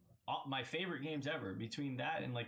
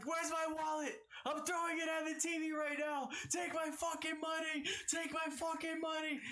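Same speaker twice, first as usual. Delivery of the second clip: hyped yelling. Retold or imitated. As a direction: demand to buy it right now. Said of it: "He's like".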